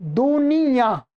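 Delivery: slow "दुनिया" with nasal final -yaan; nasal ending. Shown as a demonstration. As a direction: draw out "दुनिया" and nasalise its last vowel. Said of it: "आपको ये ऐसा लिखा हुआ मिलेगा".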